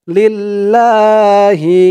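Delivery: লিল্লাহি with লা drawn out; slow, elongated la; long la. Shown as a demonstration. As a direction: stretch the লা in লিল্লাহি. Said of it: "লা টান হবে".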